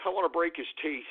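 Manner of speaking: low, soft, quick menace; muttered threat. Real, not acted.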